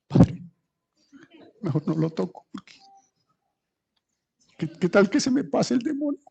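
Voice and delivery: high, hushed nervous worry; mock fearful believer. Believer afraid of catching the demon, hushed mock worry. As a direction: hold back in pretend fear.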